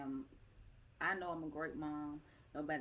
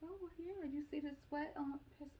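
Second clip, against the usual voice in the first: playful high answer; 9 semitones higher than usual. Retold or imitated. Mom replying to caring daughter, playfully high. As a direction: answer in a high, put-on little voice.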